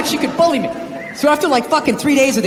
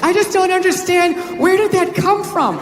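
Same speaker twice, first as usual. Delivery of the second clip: higher-pitched imitation of a wife; mock wifely exasperation; baffled wife; 6 semitones higher than usual. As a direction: plead in bewilderment, voice lifted. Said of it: "my wife always says the same thing".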